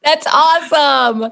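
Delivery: slow, laughing delight. Real, not acted. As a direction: laugh through the words. Laughing at sausage slang.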